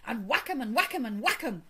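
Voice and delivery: loud, rhythmic, eager chant; excited cry. A narrator's reading. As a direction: relish each blow. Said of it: "cried the toad".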